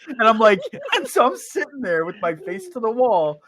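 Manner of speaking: normal talking voice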